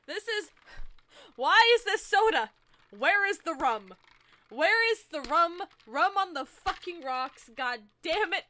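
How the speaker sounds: loud, exasperated demand; indignant outburst. Real, not acted. Finding soda instead of rum, indignant outburst.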